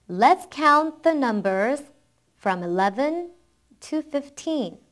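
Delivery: slow, phrase by phrase with pauses; slow model reading. Shown as a demonstration. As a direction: read it slowly for learners to follow. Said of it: "한번 빨리 읽어볼게요". (끊어 가며 천천히 따라 읽기 시범)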